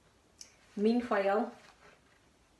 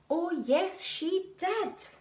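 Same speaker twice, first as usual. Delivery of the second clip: pantomime retort; 9 semitones higher, much slower than usual. Acted. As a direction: shout it back like a panto crowd. Pantomime call and response.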